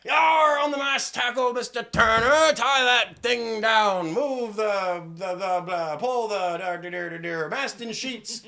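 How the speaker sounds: loud, high-pitched hammed-up pirate bellow; rowdy seafaring holler; pirate sailors. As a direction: shout garbled orders across a deck like a pirate. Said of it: "you begin to hear other voices".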